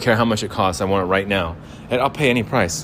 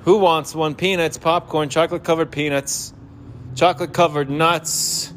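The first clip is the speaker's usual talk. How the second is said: high carrying call of a ballpark vendor; stadium vendor hawking; 5 semitones higher than usual. Acted. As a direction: hawk snacks up and down the stands. Vendor calling out wares.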